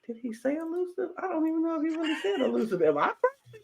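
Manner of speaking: very high, fast, frantic muttering; panicked self-doubt. Retold or imitated. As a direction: spiral into doubt, rushing high. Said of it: "had to think about".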